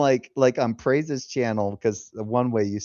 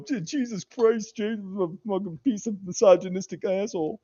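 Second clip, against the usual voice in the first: lampoon a scandalised stream viewer; mock outraged viewer; 8 semitones higher than usual. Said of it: "where people go".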